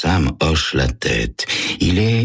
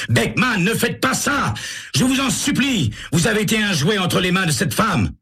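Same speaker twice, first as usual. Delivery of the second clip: much higher, pleading cry; desperate plea. A narrator's reading. Sam rushes in and cries out to Beckman, high and desperate. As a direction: beg him to stop with everything. Sam bursts in after gunshot.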